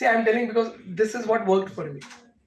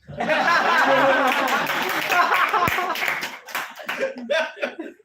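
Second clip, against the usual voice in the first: long real laugh, much higher and louder; hearty laugh; 9 semitones higher, 6 dB louder than usual. Real, not acted. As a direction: burst out laughing at the joke. Laughs at his own advice.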